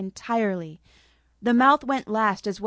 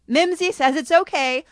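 higher and louder call across the yard; 8 semitones higher, 6 dB louder than usual. Acted. Lily calls out to Ruby from the cottage. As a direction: call out to her sister.

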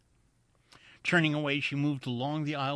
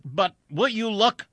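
higher, loud demand; exasperated shout; 10 semitones higher, 9 dB louder than usual. A narrator's reading. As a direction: shout over the pilot to make him look. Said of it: "shouted Wilson".

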